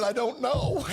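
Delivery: high, drawn-out mock helplessness; hammed-up cluelessness; retold reply. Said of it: "I said".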